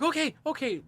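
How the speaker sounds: high, loud retold fluster; panicked compliance. Man with hornet on his head obeying, panicked. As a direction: agree in a frightened squeak.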